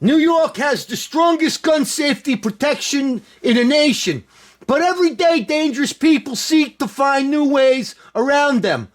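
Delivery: hammed-up Cuomo impression; Cuomo accent. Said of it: "let me do my Cuomo accent".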